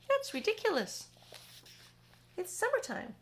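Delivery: mock the very idea; scoffing centipede. Centipede voiced in story.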